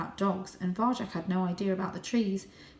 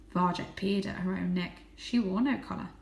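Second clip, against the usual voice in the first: plain reading voice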